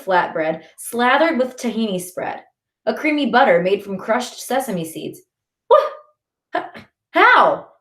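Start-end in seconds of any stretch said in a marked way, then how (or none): 5.7-7.7 high, stammered surprise; sputtering. A narrator's reading. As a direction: sputter in amazement. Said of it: "I sputter".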